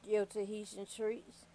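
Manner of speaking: ordinary talk, nothing marked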